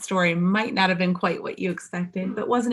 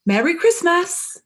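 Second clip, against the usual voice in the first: joyful greeting; 12 semitones higher, 7 dB louder, slower than usual. A narrator's reading. Farewell greeting.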